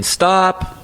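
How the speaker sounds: Chloe complaining. Retold or imitated. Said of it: "I heard Chloe saying".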